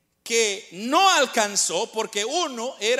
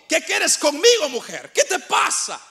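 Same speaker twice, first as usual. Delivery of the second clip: sharp, higher and louder, irritated; annoyed tone; 5 semitones higher, 5 dB louder than usual. Shown as a demonstration. Preacher shows how an angry tone would sound. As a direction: snap it like an annoyed son. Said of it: "si yo cambio el tono y yo digo".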